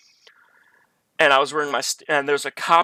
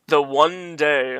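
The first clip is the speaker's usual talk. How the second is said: "The one day" drawn out slowly and a bit louder; slow emphasis; 4 dB louder, much slower than usual. Real, not acted. Telling viewers about his mom's last day, slow stressed emphasis. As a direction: lean hard on "The one day", taking your time.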